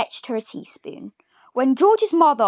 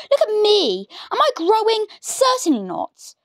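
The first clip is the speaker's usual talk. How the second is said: put-on higher, mean old woman's voice; nasty Grandma; 10 semitones higher than usual. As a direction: sneer it like a wicked granny. Grandma lectures.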